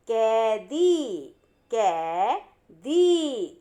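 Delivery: कैदी stretched very slowly, ai drawn long; slow sounding out. Shown as a demonstration. Teacher modelling कैदी for pupils, very slowly. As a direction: drag कैदी out slowly for the class. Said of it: "जैसा नहीं पढ़ना है।".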